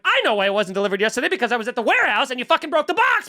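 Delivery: fast, irate retelling; mock-furious caller losing it; spiraling rant. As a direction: race through the accusation, climbing in pitch. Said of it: "I'm spiraling".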